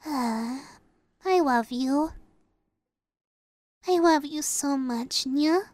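soft, slow and low; tender. Acted. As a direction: murmur it lovingly at bedtime. Cuddling at bedtime.

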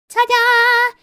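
loud proud ta-da; excited reveal. Acted. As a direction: show it off with a flourish. Showing off clean kitchen.

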